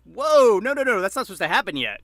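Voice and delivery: high, loud whoa, then protesting no no no; shocked disbelief. Retold or imitated. Kid hearing a young star died, loud shocked disbelief. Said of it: "you're like".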